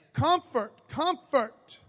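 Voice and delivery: loud, high, ringing call of comfort; booming declaration of comfort; loud proclamation. Shown as a demonstration. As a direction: proclaim each comfort with force. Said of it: "Let me go ahead and say that twice".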